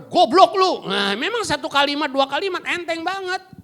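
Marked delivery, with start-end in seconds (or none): 0.0-0.9 loud, high snapped insult; harsh insult. Retold or imitated. Parent insulting a child, loud and harsh.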